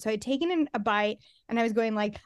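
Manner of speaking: usual speaking voice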